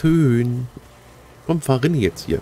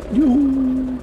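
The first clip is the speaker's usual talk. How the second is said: high, loud juhu; gleeful cheer; 12 semitones higher, 3 dB louder than usual. Real, not acted. Gamer cheering as the plane arrives, high and loud.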